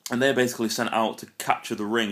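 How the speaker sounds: normal talking voice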